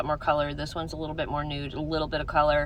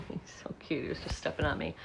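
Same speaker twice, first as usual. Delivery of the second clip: whispering; 9 dB quieter, faster than usual. Real, not acted. Whispering near the cat.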